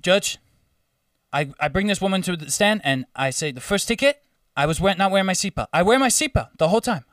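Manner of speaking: imitated African accent, courtroom testimony. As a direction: plead your case in a put-on African accent. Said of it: "I'm gonna do the accent".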